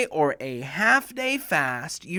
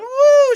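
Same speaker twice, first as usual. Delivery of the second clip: much higher, loud woo of joy; a girl's cheer as she wins; excited whoop; 14 dB louder than usual. Retold or imitated. Alia cheering loudly after her name is called. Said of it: "She went".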